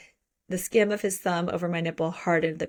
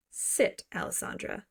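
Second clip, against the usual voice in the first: whispered order, softer on the name; hushed command; 6 dB quieter than usual. Acted. Book hero repeats his order to her, hushed command. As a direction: order it under the breath, fading off.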